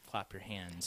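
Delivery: "clap your hands" said low, quiet and dark; ominous. Shown as a demonstration. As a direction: make "clap your hands" sound menacing and hushed. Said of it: "You did a pretty good job there".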